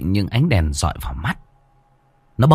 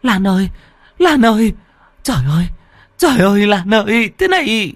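high, panicked wailing cries; terrified wail; 12 semitones higher than usual. Acted. Hằng wails in panic at her possessed friend. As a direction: cry out for a friend in horror.

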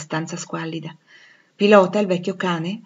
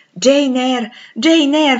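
loud, high cry of her name; overwhelmed cry; 7 semitones higher, 9 dB louder, much slower than usual. Acted. Rochester able to say nothing else to Jane, overwhelmed cry. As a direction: call the name in sheer amazement.

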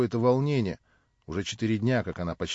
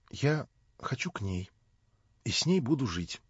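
timid whisper; 2 semitones higher, 3 dB quieter than usual. A narrator's reading. Nelli timidly asks the narrator to let her live with Natasha, whispering. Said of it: "робко взглянув на меня".

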